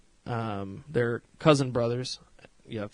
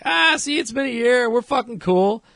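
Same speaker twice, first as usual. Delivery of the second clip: high, loud, hammed-up bishop impression; mock cocky bishop; 11 semitones higher, 8 dB louder than usual. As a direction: crow it loudly in a high put-on voice. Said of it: "the head bishop who's like".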